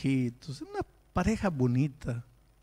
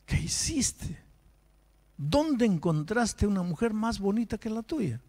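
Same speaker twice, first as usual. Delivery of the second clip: high, disbelieving reproach; higher-pitched voice of a baffled pastor; incredulous rebuke; 9 semitones higher than usual. Retold or imitated. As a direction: challenge him, baffled and scolding. Pastor scolds unfaithful husband.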